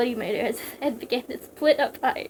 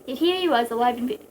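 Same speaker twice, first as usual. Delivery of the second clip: plain reading voice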